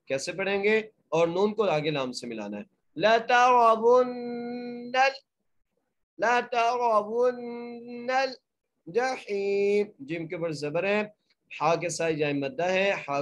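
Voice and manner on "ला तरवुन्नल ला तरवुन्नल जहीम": very slow chant, thick ra in तरवुन्नल; nasal ghunna on the doubled nun; heavy ra. Shown as a demonstration. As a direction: recite ला तरवुन्नल जहीम melodically. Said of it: "नून के ऊपर गुन्ना करेंगे".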